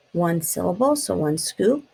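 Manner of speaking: usual speaking voice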